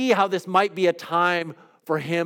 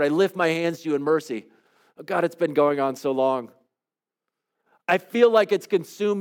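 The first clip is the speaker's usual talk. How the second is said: praying a psalm of lament aloud; heartfelt prayer. Acted. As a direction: pour out a lament before God.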